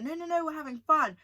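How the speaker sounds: quick high-pitched brush-off, imitating guests; mock insistence. Guests brushing off the host's plea to interact, mock insistent.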